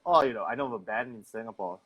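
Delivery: normal talking voice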